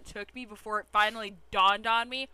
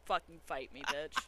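usual speaking voice

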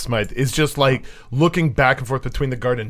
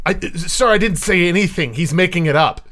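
higher, louder, stammered denial; flustered protest; 7 semitones higher, 5 dB louder than usual. Acted. Accused guard answering the head guard, high flustered protest. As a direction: protest your innocence to a superior.

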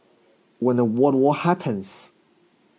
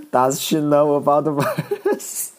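laughing while reading. Real, not acted. Comedian reading a roast comment aloud, laughing. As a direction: read it through giggles.

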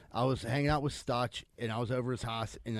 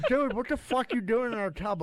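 high, incredulous friend's voice; shocked roommate; 10 semitones higher, 3 dB louder than usual. Retold or imitated. Roommate finding a guy in his tub, high and incredulous. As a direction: demand an answer in disbelief.

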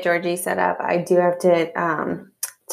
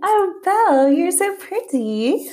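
cooing admiration; 12 semitones higher, slower than usual. Real, not acted. Co-host admires the little girl dressed as Belle, cooing. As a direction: gush sweetly, pitched way up. Admiring a small child's costume.

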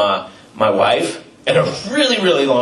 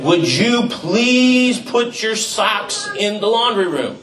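exasperated dad plea; 10 semitones higher, slower than usual. Retold or imitated. Dad quoting himself to his boys.

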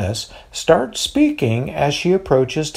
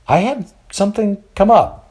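high, sheepish excuse; demurely; 8 semitones higher than usual. A narrator's reading. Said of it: "Barnabas responds demurely".

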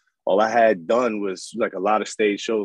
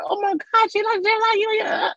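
put-on much higher old lady gush; imitating grandmother. Grandmother gushing over the baby photo, high voice. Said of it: "My grandmother was like".

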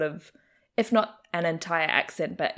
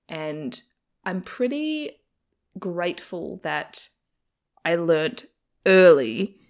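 ordinary talk, nothing marked

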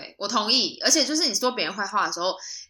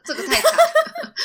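laughing; 3 dB louder, slower than usual. Real, not acted. Laughing at the embarrassing idea.

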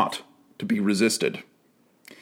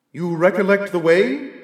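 a lightly voiced solemn spirit, higher; ghostly spirit voice; 5 semitones higher than usual. A narrator's reading. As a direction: speak as a calm, grave ghost. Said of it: "inquired the spirit".

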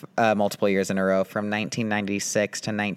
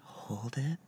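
soft, gentle calm grounding exercise; therapist voice; 3 semitones higher, 6 dB quieter than usual. Pretend. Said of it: "my therapist voice".

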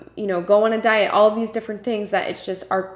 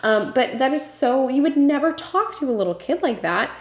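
higher-pitched, impassioned insistence; heartfelt, emphatic protest in a raised pitch; passionate; 6 semitones higher than usual. Real, not acted. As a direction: speak up with real passion, pitch lifted. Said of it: "You can probably hear how passionate I am".

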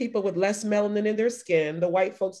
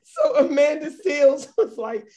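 higher pitch, talking through laughter; can barely get the words out for laughing; real giggling fits breaking up the words; laughing hysterically; 7 semitones higher, 3 dB louder, slower than usual. Real, not acted. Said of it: "I'm hysterical".

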